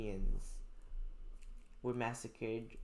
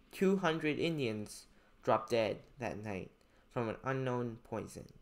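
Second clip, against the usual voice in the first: clear, louder classroom reading of a passage; reading aloud; 6 dB louder than usual. Acted. Teacher reading a story passage to students, clear read-aloud. Reads history text aloud.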